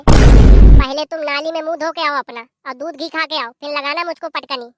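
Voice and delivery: slow, loud mocking yell; taunting shout. Wife loudly taunting her mother-in-law.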